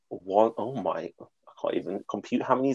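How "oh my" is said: ordinary talk, nothing marked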